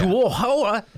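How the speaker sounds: imitating the video's Australian narrator, half-joking; mock Australian accent. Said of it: "I can't do accents".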